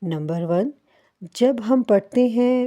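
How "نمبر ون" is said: usual speaking voice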